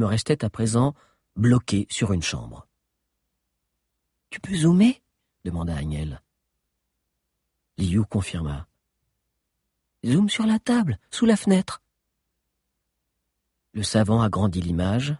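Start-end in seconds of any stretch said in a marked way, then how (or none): 4.3-5.0 put-on higher light girl's voice; a lightly voiced young Agnès. Agnès asks to zoom. 10.1-11.8 put-on higher young character voice; Agnès voice. Agnès asks to zoom.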